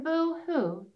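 high pretend boo-hoo; hammed-up little sob; mock crying. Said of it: "said the hedgehog".

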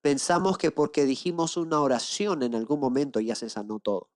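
ordinary delivery

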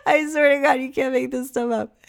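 high, laughing insistence; helpless laughter. Real, not acted. Swearing the story about mom is true, laughing hard. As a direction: squeeze it out after a laughing fit.

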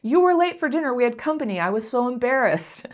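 a wounded partner listing the offense; upset complaint. Pretend. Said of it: "You can express yourself and say".

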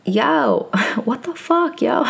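high New York accent, mock outrage; brash New Yorker. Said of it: "I may have a New York moment".

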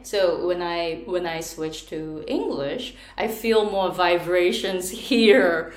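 slow, forward-placed English with buzzing resonance; resonant English voice. Shown as a demonstration. Teacher demonstrating how her voice changes in English, slow and resonant. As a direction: let the voice ring in the bones as you switch to English. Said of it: "この声変わるじゃないですか".

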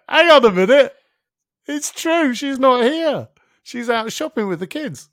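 the penny drops, loud and high; startled realization. Retold or imitated. Alone in his kitchen, realizing his wife is out: startled and loud.

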